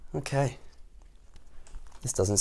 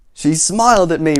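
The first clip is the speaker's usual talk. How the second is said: loud, higher soldier's complaint; indignant storybook soldier; soldier repeating accusation; 4 semitones higher, 8 dB louder than usual. Acted. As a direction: repeat the charge emphatically. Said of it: "repeated the soldier".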